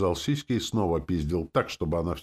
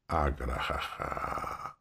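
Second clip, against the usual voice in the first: slow, low demonic guffaw; evil cackle; 3 semitones lower, 6 dB quieter, much slower than usual. A narrator's reading. Demon gloating over her victim, evil cackle. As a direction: laugh like a gloating demon, drawn out. Demon villain laughs after threats.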